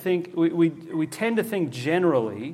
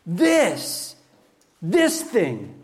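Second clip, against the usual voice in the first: a hint of God pointing, lifted higher and drawn out; voicing God; 9 semitones higher, slower than usual. Pastor voicing God's words to believers, lightly raised. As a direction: lightly voice the Lord singling out one deed. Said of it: "He's saying".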